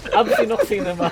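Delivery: high, loud meme exclamation; loud excited. Pretend. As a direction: belt it out like a hype call. Repeating the meme phrase louder.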